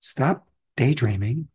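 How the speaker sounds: ordinary delivery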